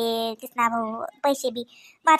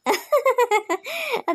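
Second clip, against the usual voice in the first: high cheeky giggle; giggling; 8 semitones higher than usual. Real, not acted. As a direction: giggle at your own cleverness. Laughs at own trick.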